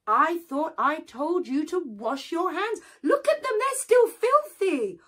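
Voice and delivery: scolding. Acted. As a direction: scold in high outrage. Anansi scolds again.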